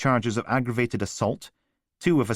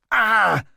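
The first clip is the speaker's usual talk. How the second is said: sharp high yelp; cry of pain; 14 semitones higher, 8 dB louder than usual. Acted. Callum squeezed on broken ribs, a sharp high yelp of pain.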